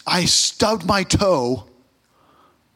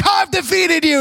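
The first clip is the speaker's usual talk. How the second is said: higher mock-shouted victory cry; triumphant knight; 11 semitones higher than usual. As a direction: gloat like a movie swordsman. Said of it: "he's like".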